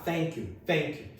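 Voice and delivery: "thank you" starting with an F sound. Acted. Learner told to use F instead of TH, F-substituted "thank you". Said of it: "fala um som de F".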